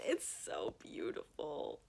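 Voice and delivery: "It's so beautiful" whispered low and slow, breathless; hushed awe. Real, not acted. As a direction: sigh "It's so beautiful" under your breath in wonder. Admiring the cover.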